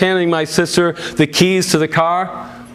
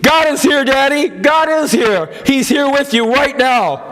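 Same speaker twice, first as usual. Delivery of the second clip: fervent scream; 9 semitones higher than usual. A narrator's reading. Daughter assuring her dying father God is with him, screaming and much higher. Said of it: "I screamed".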